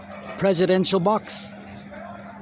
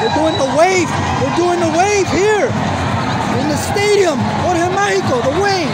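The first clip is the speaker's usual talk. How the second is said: loud thrilled shouting, high; excited shouting; 9 semitones higher, 6 dB louder than usual. Real, not acted. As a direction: yell it over the crowd. Excited in stadium doing wave.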